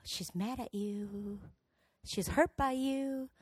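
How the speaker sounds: soft, drawn-out inner voice; intrusive thought. Acted. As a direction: plant the doubt slowly and quietly. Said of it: "I would get this thought".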